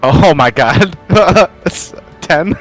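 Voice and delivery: ordinary talk, nothing marked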